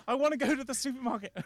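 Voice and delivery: put-on high, quick little-kid voice; whiny child role. Child begging a busy mum for an outing, high-pitched pleading.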